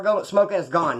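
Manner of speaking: ordinary talk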